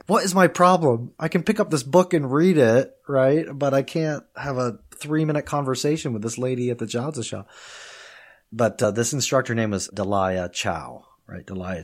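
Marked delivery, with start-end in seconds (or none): 0.1-7.5 exasperated past self. Retold or imitated. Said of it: "I was just like".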